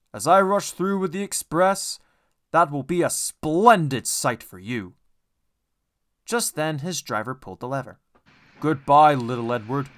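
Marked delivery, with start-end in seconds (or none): 0.1-5.0 loud, proud big engine; boastful Gordon. A narrator's reading. Gordon boasting to little Edward, loud and proud. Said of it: "he boasted". 8.6-9.9 loud, proud big engine; boastful Gordon. A narrator's reading. Gordon boasting to little Edward, loud and proud. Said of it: "said Gordon".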